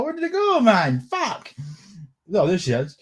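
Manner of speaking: ordinary talk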